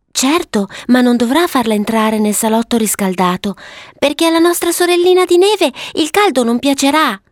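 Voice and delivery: put-on high child's voice, climbing at the end; little girl Violetta. Violetta warning her brother about the warm parlour, high girlish voice. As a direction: play a little girl laying down rules.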